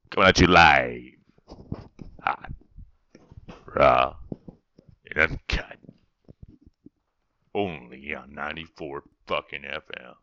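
loud, low slogan delivery; radio announcer. Pretend. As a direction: boom it out like a DJ promo.

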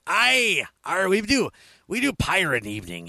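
put-on growly pirate accent, loud and high. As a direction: ham up a pirate's cry. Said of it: "good accent over the chat room".

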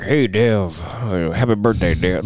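lightly voiced Southern crooner drawl. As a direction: greet him with a touch of the King. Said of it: "just talking like [name]".

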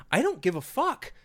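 high-pitched, indignant dismissal; worked-up, fed-up ranting; exasperated rant. Real, not acted. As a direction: protest it with the voice riding high. Exasperated rant, not caring about sequel.

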